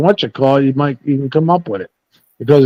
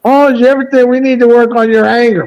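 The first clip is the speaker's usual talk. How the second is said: mocking counselor; 8 semitones higher than usual. Mimicking the counselor.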